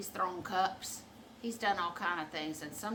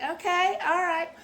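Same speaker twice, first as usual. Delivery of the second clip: higher, louder mock acceptance; mock going along; 11 semitones higher, 11 dB louder than usual. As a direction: play along with a loud, high okay. Mimicking her own bemused reaction.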